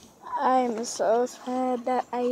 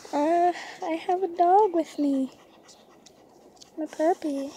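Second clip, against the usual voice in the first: normal talking voice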